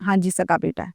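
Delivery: retold low, soft answer; subdued reply. Father answers the police about his son, subdued. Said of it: "تو police نے پوچھا".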